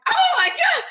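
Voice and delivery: high, loud mock exclamation; delighted squeal. Mother reacting to her daughter's advice, delighted squeal.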